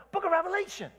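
high, rising preacher fervor; fired up. Real, not acted. Preacher cheering up downcast people, fired-up proclamation. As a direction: proclaim it like a rallying cry. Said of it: "I'm getting all fired up".

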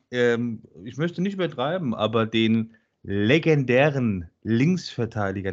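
ordinary delivery

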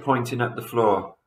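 ordinary delivery

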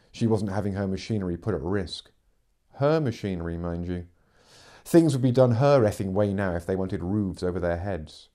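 usual speaking voice

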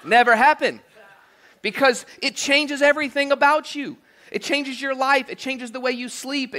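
preacher building fervour on a point; impassioned preaching. Acted. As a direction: drive it home from the pulpit. Passionate preaching peak.

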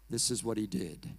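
ordinary talk

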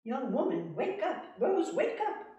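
rising, loud wake-up call; commanding king. Acted. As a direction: rouse her briskly. King wakes Rose.

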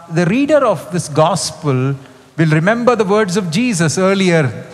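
normal talking voice

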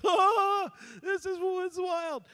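high, loud scared "oh"; half-panicked awe; scared amazement. Retold or imitated. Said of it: "you're just like".

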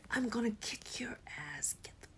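hissed threatening whisper. Acted. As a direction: whisper a threat under your breath. Threatening sister, hidden from phone.

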